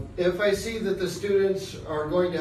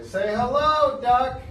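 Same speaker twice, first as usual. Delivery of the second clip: cheery prompt; 8 semitones higher, 6 dB louder, slower than usual. Shown as a demonstration. Teacher prompting the class.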